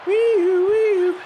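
high imitated siren wail; very high two-note siren noise; ambulance siren. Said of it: "a little ambulance come out of nowhere".

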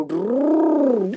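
a long rolled-r truck engine drone; rolled engine rumble. Pretend. Playing with toys, sending the dumper truck off with an engine rumble. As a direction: rumble it like a toy truck pulling away. Helicopter flying off.

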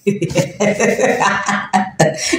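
low, breathy chuckle running on; real laugh. Real, not acted. Streamer who laughs a lot, real chuckling. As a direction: laugh it out in a long voiceless run. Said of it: "je ris beaucoup".

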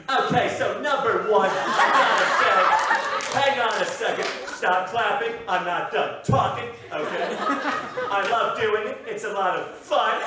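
high, drawn-out stand-up impression; John Mulaney. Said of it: "just had John Mulaney on the show".

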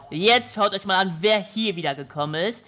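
usual speaking voice

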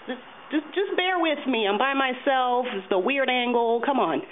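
high-pitched plea for patience; plead, starting high and easing down. Acted. Performer by himself asking for patience, high pleading voice.

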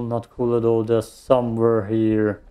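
ordinary delivery

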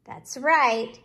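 ordinary delivery

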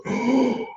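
1 imitated excited gasp. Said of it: "And she goes".

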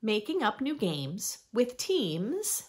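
lively, sing-song picture book reading; reading to children. Acted. Reading a rhyming book to young listeners, bright storytime voice. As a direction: read it like storytime for little ones.

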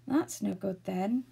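slow, resigned remark; slow, mildly deflated delivery; sighing. A narrator's reading. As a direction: say it on a sigh, disappointed. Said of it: "said Joe with a sigh".

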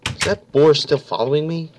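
ordinary talk

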